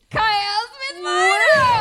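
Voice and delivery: slow, high, full-voiced shout; big announcement. Real, not acted. Announcing a cast member to the crowd, drawn-out excited shout. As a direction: belt out the name like a hype announcer.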